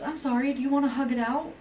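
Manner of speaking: high-pitched mock peace offering; retold friendly offer. Quotes friend offering a hug.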